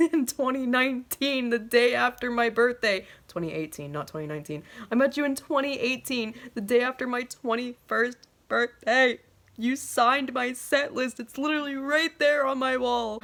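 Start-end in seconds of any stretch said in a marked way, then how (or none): 0.1-3.1 high-pitched, hammed-up indignant reminder; mock exasperation. Fan reminding singer they met, mock exasperated. 4.9-13.2 high-pitched, insistent mock indignation; mock exasperation. Fan reminding singer they met, mock exasperated.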